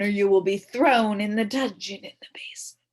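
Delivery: a hint of a menacing king; mock king threat. A narrator's reading. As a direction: threaten like a storybook tyrant.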